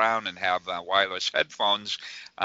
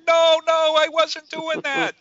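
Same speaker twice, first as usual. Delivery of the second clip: high, loud panicked denial as Jerry; high, loud flustered denial, caught in the act; 7 dB louder than usual. Retold or imitated. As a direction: squeal out an embarrassed denial. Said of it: "he's going".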